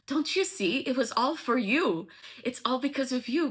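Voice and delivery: high-pitched lovestruck pleading; acted fervent confession; impassioned plea, retold.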